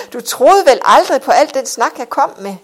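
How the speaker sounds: loud, high, swooping through laughter; highly amused. A narrator's reading. Husband laughing that his wife believed his talk, uproarious. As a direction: tease loudly, carried by the laugh.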